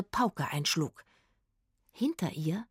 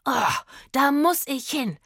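high, loud, drawn-out longing cry; excited yell; 7 semitones higher, 5 dB louder than usual. A narrator's reading. Hungry Rufus wants to reach the cherry trees, excited yell. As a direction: cry out slowly with greedy urgency. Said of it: "schrie Rufus".

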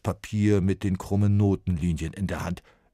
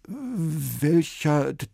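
slow, higher, faltering and stuttered; trembling voice; 10 semitones higher, 6 dB louder, slower than usual. A narrator's reading. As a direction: ask with a shaking voice. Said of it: "mit zittriger Stimme".

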